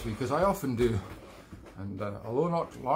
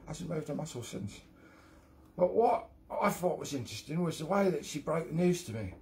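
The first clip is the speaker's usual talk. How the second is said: put-on a slightly raised, cheeky London accent telling a joke; London accent as Sid Snot; 3 semitones higher than usual. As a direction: spin the yarn in a London accent, building to the punchline. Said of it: "the London accent right this time".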